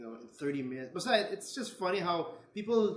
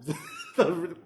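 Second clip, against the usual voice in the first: laughing. Real, not acted. Laugh breaks the sentence.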